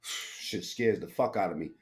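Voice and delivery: ordinary talk, nothing marked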